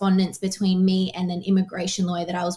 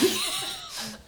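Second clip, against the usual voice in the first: breathy laugh; 2 semitones higher, 7 dB quieter than usual. Real, not acted. Laughing at her own fears.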